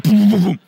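loud booming thuds of a fall; loud mock crash noises; tumbling sound effect. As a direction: boom it out like bodies hitting the floor. Imitating the tumble.

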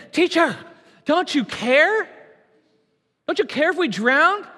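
high, pleading cry of scared disciples; a touch of panic, pitched high; panicked plea. Acted. Frightened disciples waking Jesus in the storm, high and pleading.

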